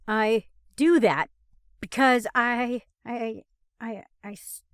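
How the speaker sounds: halting, stuck repetitions; stuttering. A narrator's reading. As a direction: trip over the words. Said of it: "Stop stuttering!".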